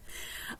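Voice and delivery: soft, voiceless chuckle; quiet laugh. Real, not acted. Storyteller laughing at her own sleepless night, soft chuckle. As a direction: laugh under your breath.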